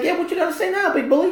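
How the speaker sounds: high-pitched taunt; little kid trash-talk. Retold or imitated. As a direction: goad the bully as an emboldened kid. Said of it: "now he can talk all that trash".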